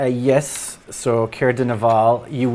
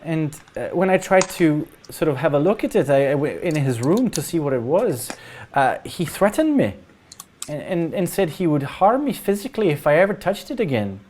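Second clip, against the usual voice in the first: higher-pitched, halting villager recounting a threat; worried parent character; 5 semitones higher than usual. Acted. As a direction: play a troubled parent, pitched up, stumbling over words.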